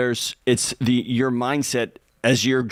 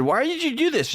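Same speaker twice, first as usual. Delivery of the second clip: much higher mock-baffled why; baffled question; 14 semitones higher than usual. Husband asking wife about her banned-questions list, high and baffled. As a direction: ask it high and incredulous.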